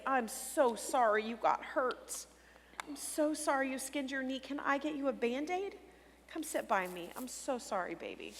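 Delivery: soothing parent. Shown as a demonstration. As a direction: hush and soothe a child with a skinned knee. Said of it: "meet them where they are and say".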